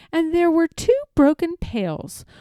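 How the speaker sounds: ordinary delivery, nothing marked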